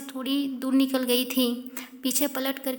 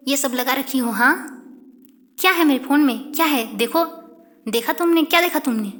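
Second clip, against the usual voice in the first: louder, hammering each question; angry confrontation; 6 dB louder, faster than usual. Acted. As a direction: demand answers, rising anger. Karishma angrily demands explanation.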